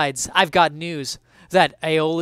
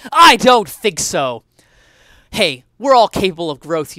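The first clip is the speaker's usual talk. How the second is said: emphatic retort; 2 semitones higher, 12 dB louder than usual. Acted. Dismissive retort.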